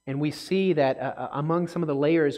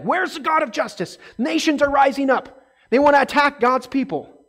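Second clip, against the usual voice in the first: desperate complaint; 10 semitones higher, 5 dB louder than usual. Retold or imitated. Voicing Malachi's complaint.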